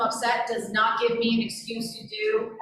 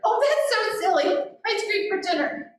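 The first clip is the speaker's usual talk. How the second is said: much higher, slower playful amusement; playful delight; 10 semitones higher than usual. Retold or imitated. Parent to child wanting ice cream, high playful delight. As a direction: delight in the silly idea. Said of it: "You know, like".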